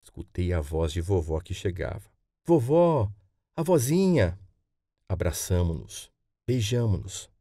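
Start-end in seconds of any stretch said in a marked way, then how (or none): 2.4-4.4 high, loud joyful call; acted child's glad cry, pitched up; delighted greeting. Boy calls to his arriving grandmother, acted delighted cry.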